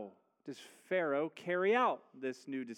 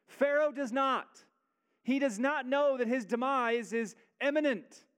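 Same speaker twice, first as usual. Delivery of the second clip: higher, louder preacher building to a climax; rising preaching; 8 semitones higher, 6 dB louder than usual. Acted. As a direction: drive it home from the pulpit.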